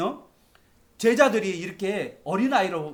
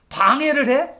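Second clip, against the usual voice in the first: indignant; 7 semitones higher, 8 dB louder than usual. Retold or imitated. Voicing the indignant disciples.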